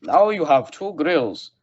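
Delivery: bad German movie villain accent. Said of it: "That's a bad German accent".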